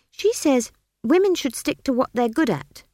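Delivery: pert, high-pitched retort; defiant child. A narrator's reading. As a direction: argue back like a stubborn child. Child Esk argues.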